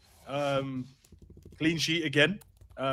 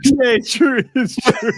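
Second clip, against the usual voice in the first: high laughing agreement; 12 semitones higher, 6 dB louder, slower than usual. Real, not acted. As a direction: laugh through the words.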